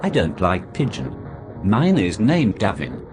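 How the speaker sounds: ordinary talk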